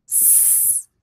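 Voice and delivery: quiet unvoiced sss while sounding out; hissed s. Shown as a demonstration. Teacher blending letters for a child, soft hiss. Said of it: "sounding out vaso".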